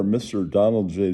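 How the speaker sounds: usual speaking voice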